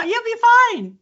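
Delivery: very high, loud, laughing; laughing reassurance. Real, not acted. Coach reassuring the host before an accent lesson, laughing. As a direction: squeal it through real laughter.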